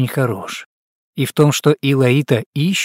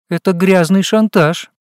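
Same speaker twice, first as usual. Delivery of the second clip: playful fake outrage, raised pitch; mock indignation; 6 semitones higher, 3 dB louder than usual. A narrator's reading. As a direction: act pretend offended, higher. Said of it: "в притворном возмущении вскинула подбородок Илаита".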